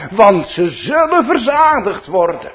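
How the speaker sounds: loud, high and sure, stress on zullen; with great certainty. Retold or imitated. Preacher quotes Christ's promise to the poor, with great certainty. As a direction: declare "zullen" firmly, leaning hard on it. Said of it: "met grote zekerheid".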